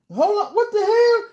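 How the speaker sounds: panicked shriek as the doorknob turns; shocked yell. Retold or imitated. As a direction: react to the door opening. Door opening on him.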